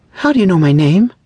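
high, loud startled question; startled alarm. A narrator's reading. Woman to a stranger who knows her name, alarmed.

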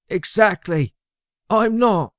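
high, emphatic underling; protesting sidekick. A narrator's reading. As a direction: object in a high-pitched voice. Magnus protests he is mortal.

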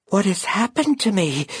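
high, anxious raven voice; a raven suddenly lost and frightened; bewildered raven. Acted. Concord waking changed, high and bewildered.

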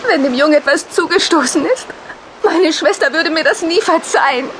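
ordinary talk

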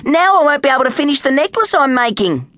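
put-on high, upset child's whine; lamenting. A narrator's reading. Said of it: "lamented Kylie".

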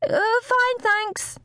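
very high-pitched call through a door; high, squeaky over-bright reply; shrill shout. A narrator's reading. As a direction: call out shrilly, pretending to be fine. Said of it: "Amy shouted over shrilly".

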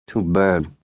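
mumbled through a mouthful of cookies; mouth full. A narrator's reading. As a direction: mumble it while chewing. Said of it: "he mumbled through crumbs".

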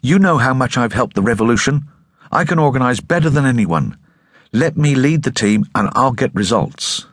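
high, pressing insistence; insistent. Acted. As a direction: refuse to back down. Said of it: "she wouldn't yield".

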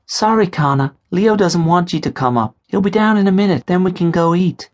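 high female voice; young woman. A narrator's reading. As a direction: politely refuse him. Katie refusing him entry.

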